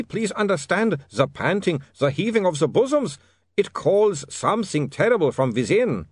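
high, anguished foreign vampire; tormented vampire. Acted. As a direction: wail out the torment in a high accent. Otto wailing about his vampire urges.

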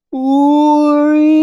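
high, drawn-out wail; loud insistent cry. A narrator's reading. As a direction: call long, high and loud. Tanny calling out for a story.

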